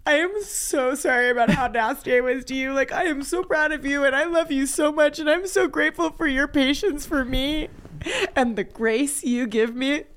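high, tearful gushing, acted; imitated crying apology. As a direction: pour out apology and gratitude in a mock-weepy voice.

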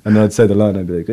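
usual speaking voice

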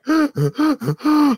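loud, high gasping sobs, imitated; big mock sob-gasps; heaving sobs. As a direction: act out heaving, gasping breaths of crying. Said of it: "like heaving".

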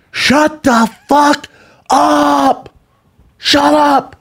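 long, high-pitched drawn-out yell; retold yell. Audience member yelling at noisy talkers, high drawn-out shout. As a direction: imitate a heckler screaming, stretching every word.

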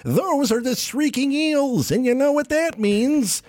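high mock radio hype; Vizzini host. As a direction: build up the prize. Radio host teasing eels.

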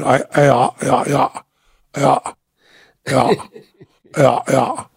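lightly imitated guttural phone agreeing; mock grunted yeahs to mom on the phone; a hint of New England phone grunts. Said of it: "that kind of guttural, weird Pepperidge Farms".

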